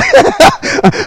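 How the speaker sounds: high laugh after his own joke; amused laugh. Real, not acted. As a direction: burst into a short giggle.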